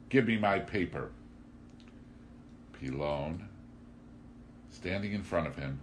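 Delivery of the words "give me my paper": raised, high demand; screamed demand. A narrator's reading. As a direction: demand it back loudly. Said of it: "he screamed".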